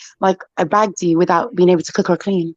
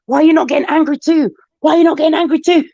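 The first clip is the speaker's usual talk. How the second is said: raised-pitch imitation of an exasperated person; hyped-up anger; 8 semitones higher, faster than usual. Acting out worked-up people annoyed by her calm friend, hyped. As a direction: demand an answer, all worked up. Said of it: "they're so hyped".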